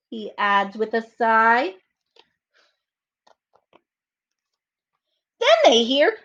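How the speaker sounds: put-on slow, lively read-aloud for children; storybook reading. A narrator's reading. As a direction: read it like story time for little kids. Reads story narration aloud.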